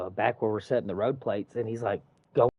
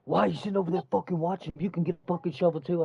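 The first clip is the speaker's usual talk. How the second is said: fast, higher-pitched indignant quote; retold angry scolding; 6 semitones higher, faster than usual. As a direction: imitate a coworker chewing you out, quickly. Retold coworker berating the speaker.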